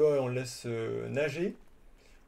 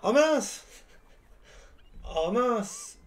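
surprised; 11 semitones higher, 7 dB louder, slower than usual. Real, not acted. Sudden exclamation, loud high.

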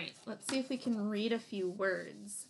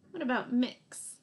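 ordinary talk, nothing marked

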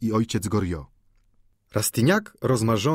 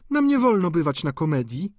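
put-on higher, prim girl voice; young girl demure; 8 semitones higher than usual. A narrator's reading. Victorine explaining they may not attend plays. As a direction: answer primly.

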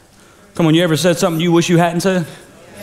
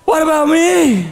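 jealous complaint; 11 semitones higher than usual. Retold or imitated. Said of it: "Saul said".

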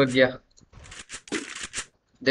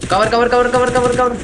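loud, higher urgent callout; 7 semitones higher, 3 dB louder than usual. Real, not acted. Streamer yelling at teammates to take cover, loud callout. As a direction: shout it fast to your team mid-fight. Calling to teammates mid-fight.

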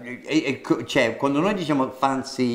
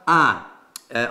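usual speaking voice

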